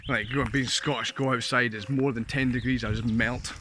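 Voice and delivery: ordinary delivery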